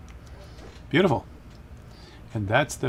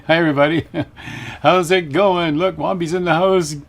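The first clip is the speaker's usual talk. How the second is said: loud and higher, thrilled to see everyone; excited greeting; 6 semitones higher, 8 dB louder than usual. Real, not acted. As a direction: greet the chat with big delight. Excitedly greeting stream viewers.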